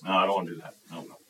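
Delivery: low, soft dismissive answer; bored refusal. Acted. Teen in church youth group refusing, bored. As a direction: shoot it down with no energy.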